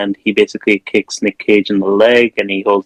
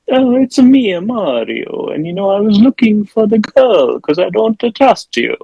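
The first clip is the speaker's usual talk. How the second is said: high, hammed-up Italian accent; stupid Italian accent; 11 semitones higher, 3 dB louder than usual. Nick Cage in disguise explaining himself to Pablo, silly Italian accent. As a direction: put on a silly singsong Italian accent, pitched up. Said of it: "really stupid Italian accent".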